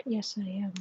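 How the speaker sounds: soft whisper to the cat; hushed reply to the cat. Real, not acted. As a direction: whisper an answer to the kitty.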